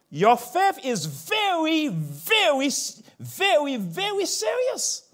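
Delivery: high, slow, building insistence; preaching emphasis. Acted. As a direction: lean hard on every very.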